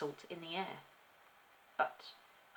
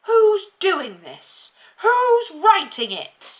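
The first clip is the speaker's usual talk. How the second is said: slow, high, very loud demand; furious drawn-out roar; yelling; 13 semitones higher, 20 dB louder, slower than usual. A narrator's reading. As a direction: bellow it at the whole room. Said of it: "she yelled at nobody in particular".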